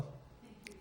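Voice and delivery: "Thank you, John" whispered, no voice; whispered aside of thanks. Real, not acted. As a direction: breathe a quick thank you off-mic.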